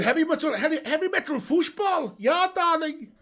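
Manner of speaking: put-on high, loud German accent; hammed-up German football fanatic voice. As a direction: belt it out as an excitable high-pitched German. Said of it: "German porn apps accent".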